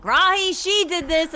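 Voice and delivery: high loud call blaming Olive; yelling to Rahi, pointing at Olive. Acted. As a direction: shout over the chaos. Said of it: "I'll scream at Rahi".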